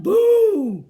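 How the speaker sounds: big, high shouted boo; yelled out. Acted. Bear jumps up to scare everyone, yelling. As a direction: startle them with a loud yell. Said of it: "he yelled out".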